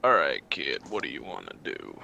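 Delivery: put-on laid-back gunslinger with an accent; stoner cowboy. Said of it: "Sounds like a stoner cowboy".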